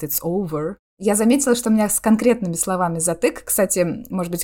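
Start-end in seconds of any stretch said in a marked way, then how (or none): 0.0-0.8 American OVER with the final R sounded; American pronunciation. Shown as a demonstration. Showing how an American would say it, American accent. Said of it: "в отличие от американца, который скажет".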